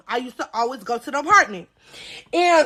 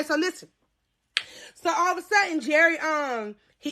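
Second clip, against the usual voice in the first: ordinary delivery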